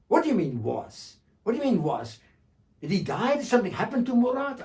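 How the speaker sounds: rising, alarmed questions. Acted. Bedou alarmed by news.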